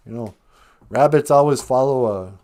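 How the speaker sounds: ordinary delivery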